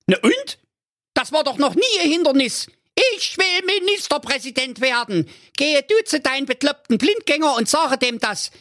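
very high commanding wife voice; bossy wife. A narrator's reading. Wife ordering husband to the dud bomb, very high bossy voice. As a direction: order him about from way up high.

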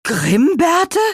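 high, loud, rising question; startled cry. A narrator's reading. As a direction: cry the name out in disbelief. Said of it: "riefen Gurkenhut und Petronella gleichzeitig".

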